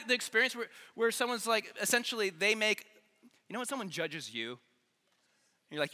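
ordinary delivery

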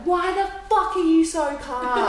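high, stretched-out retold exasperation; exasperated outburst. Her to calm partner on the phone, exasperated.

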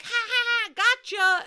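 high, loud cackling gotcha; teasing mom. Retold or imitated. Mom springing out from behind the corner, high teasing cackle. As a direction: crow like a prankster mom. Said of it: "she was like".

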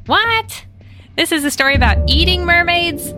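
usual speaking voice

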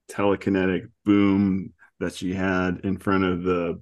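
ordinary talk

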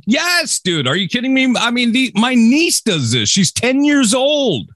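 incredulous. Real, not acted. Incredulous protest.

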